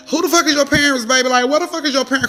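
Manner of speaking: angry retort. Retold or imitated. Driver confronting a strange child at the car door, angry retort. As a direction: demand answers, acting out the anger.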